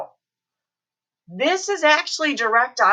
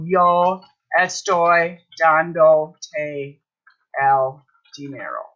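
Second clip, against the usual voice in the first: very slow, separated words; slow modelling; 4 semitones lower, slower than usual. Shown as a demonstration. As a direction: pause between "dando" and "te". Teacher builds sentence with te separated.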